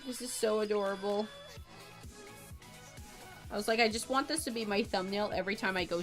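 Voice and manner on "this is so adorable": normal talking voice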